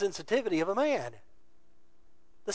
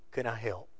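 softer, lower caring question; gentle offer; 6 semitones lower, 9 dB quieter than usual. Retold or imitated. To his crying wife, a soft caring offer. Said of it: "reach out accepting".

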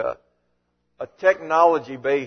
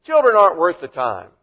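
put-on dismissive, louder, Children hit hard; 9 dB louder than usual. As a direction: brush the children off as worthless. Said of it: "The disciples were saying".

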